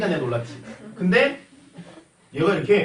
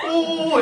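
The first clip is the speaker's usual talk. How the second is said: long, loud growling oh at the dog; slow drawn-out beast roar, playful; mock dog snarl; 11 semitones higher, 5 dB louder than usual. Teacher imitates the mad dog charging, loud mock growl. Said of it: "이러는데".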